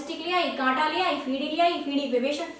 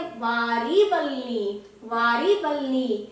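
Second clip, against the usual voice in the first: slow, drawn-out "वारी वल्ली" with "वारी" stressed; 3 semitones lower, much slower than usual. Retold or imitated. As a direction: repeat it slowly like a mnemonic. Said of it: "वो कहती है". (धीरे-धीरे खींचकर)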